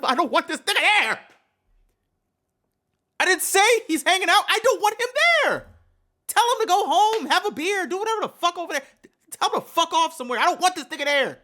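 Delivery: high, loud, heated rant; triggered outburst. Acted. Speaking for the child who isn't heard, triggered and yelling. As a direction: shout it fast as the fed-up kid. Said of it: "Sorry, I'm getting triggered".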